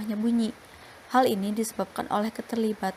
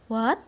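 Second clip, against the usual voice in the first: "what" swept upward at the end; rising tone. Shown as a demonstration. Student presenting an example of rising tone, "what" rising. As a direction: let "what" climb like a question. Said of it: "ada yang menaik seperti".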